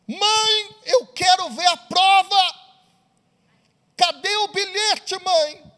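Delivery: put-on high, pleading teenage daughter; a lightly voiced, upset daughter; distraught daughter. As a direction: beg your mother for the proof.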